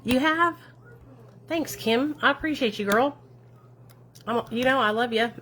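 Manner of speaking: high, loud, gushing thanks; light up and thank her warmly; bubbly shout-out to a supportive follower; excited thanks. Real, not acted.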